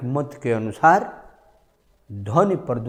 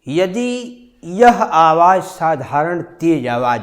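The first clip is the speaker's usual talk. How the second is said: slow, higher and much louder; loud demo; 5 semitones higher, 8 dB louder than usual. Shown as a demonstration. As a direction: project it like a loud teacher. Teacher shows loud voice. (ज़ोर से, धीरे, ऊँची आवाज़ में)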